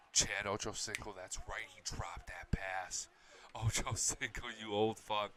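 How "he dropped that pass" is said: hushed unvoiced aside; whisper. Real, not acted. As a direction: whisper it like a secret. Fan mocking a dropped pass.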